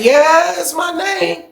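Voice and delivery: sudden high, thin nervous voice; voice cracking high. Retold or imitated. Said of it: "His voice changed and everything".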